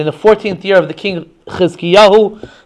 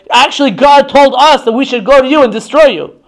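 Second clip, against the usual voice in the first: raised, emphatic mock threat; acted intimidating claim; scare tactic; 6 semitones higher, 3 dB louder than usual. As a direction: threaten them to spread panic. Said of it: "trying to really scare the masses".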